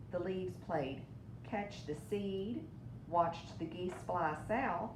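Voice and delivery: usual speaking voice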